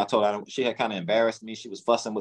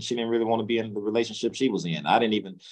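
ordinary delivery

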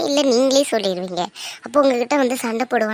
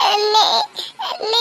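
high, teary call to her brother; tearful calling; 7 semitones higher, slower than usual. Acted. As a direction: call out to him through tears. Crying sister calls her brother. (அழுகையுடன் அண்ணனை அழைத்தல்)

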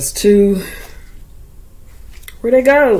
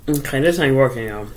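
mouth full of peanut butter. Real, not acted. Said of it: "It was all stuck to the roof of my mouth".

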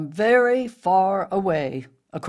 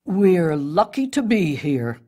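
louder, ominous tree voice; dark; 5 dB louder than usual. A narrator's reading. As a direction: answer darkly, with some force. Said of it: "Spruce answered darkly".

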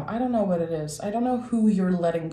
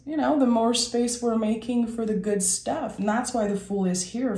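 ordinary talk, nothing marked

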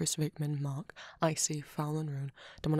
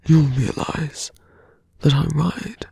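villain voice; 16 dB louder than usual. Acted. Villain taunting the little hero before leaving, close menacing whisper. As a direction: toy with the hero like a smug villain, murmured close to the ear.

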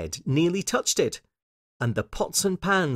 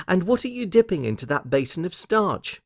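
put-on high, inquisitive child; Lucy; 7 semitones higher than usual. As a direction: keep asking, in a raised little-girl voice. Lucy asks about the starch.

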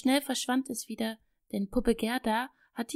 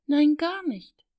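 high, loud, drawn-out pouting no; sulky; 5 semitones higher, 6 dB louder than usual. A narrator's reading. Disappointed girl sulks at her mother, loud and slow. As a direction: pout it out slowly. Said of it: "Nesthäkchen machte ein langes Gesicht".

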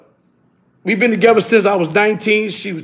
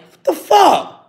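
startled protest; 10 semitones higher, slower than usual. Retold or imitated. Caught off guard, retold.

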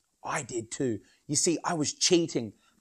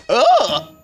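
loud high grossed-out ugh; disgusted ugh; 8 semitones higher, 12 dB louder than usual. Acted. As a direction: recoil from a bad smell. Smelly bottom reveal.

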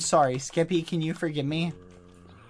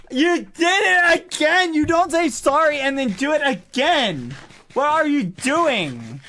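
high, loud indignant cry; outraged; 8 semitones higher, 5 dB louder than usual. Real, not acted. Scolding Skeppy for slamming the door again, high and loud. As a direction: call him out, scandalized.

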